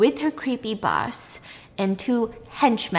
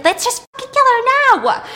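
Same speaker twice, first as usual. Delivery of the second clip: much higher, shrill furious outburst; angry arguing; 7 dB louder than usual. Retold or imitated. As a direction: demand it in a heated fight. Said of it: "they're all arguing".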